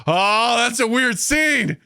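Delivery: high, drawn-out realization; mock dawning horror. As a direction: wail it slowly as it sinks in. Acts his reaction to realization.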